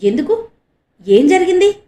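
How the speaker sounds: anxious asking. A narrator's reading. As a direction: ask urgently, rising up high. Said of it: "ఆతృతగా అడిగాడు ఆనంద్".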